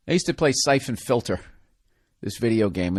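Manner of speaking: normal talking voice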